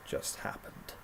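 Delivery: breathless stunned whisper. A narrator's reading. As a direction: whisper it in disbelief. Stunned after the blast.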